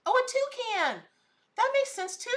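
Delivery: light up at someone else's find; excited realization. Real, not acted. Vlogger answering a viewer's toucan sighting, excited.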